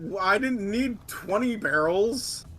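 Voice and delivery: high, slowed-down complaint; exasperated protest. Real, not acted. Player complaining to a teammate about barrels, exasperated. Playful quarrel over too many barrels.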